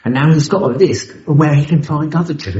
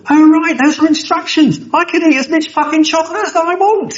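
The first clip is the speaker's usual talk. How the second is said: silly gleeful voice. Retold or imitated. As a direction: squeal it like a kid with sweets. Mock delight at the instructions.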